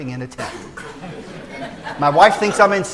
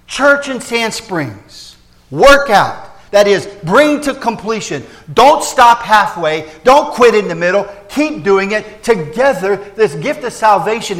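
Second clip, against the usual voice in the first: loud, slow, pounding exhortation; fervent preaching; 6 semitones higher, 8 dB louder, slower than usual. Real, not acted. Pastor urging his Sand Springs church on, loud and slow. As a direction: drive each word home to the church.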